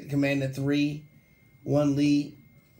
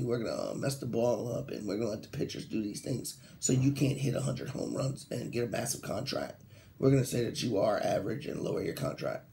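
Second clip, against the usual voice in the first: mumbled, much softer player's voice; lightly voiced player mumbling his excuse; mock player excuse; 5 semitones lower, 5 dB quieter than usual. Host mocking players' excuse, softer mumbling voice.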